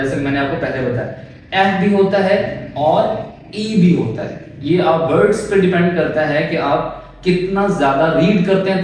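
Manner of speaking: ordinary talk, nothing marked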